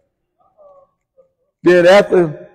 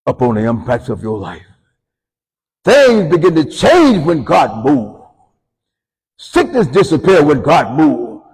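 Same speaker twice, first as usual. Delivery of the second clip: rising preacher cadence, louder, slow; preaching; 3 semitones higher, 6 dB louder than usual. Acted. Pastor rousing the congregation, preaching louder. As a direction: build the sermon to a peak.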